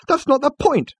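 high, loud exasperated objection; exasperated high outburst; protesting. A narrator's reading. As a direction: protest with voice shooting up. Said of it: "Arthur protested".

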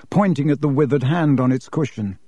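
plain reading voice

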